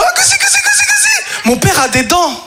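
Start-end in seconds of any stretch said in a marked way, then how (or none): 0.0-1.1 very high giggly squeal; tickled father. Retold or imitated. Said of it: "Tu lui fais".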